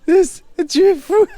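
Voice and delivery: high, loud, slow laughing; laughing loudly. Real, not acted.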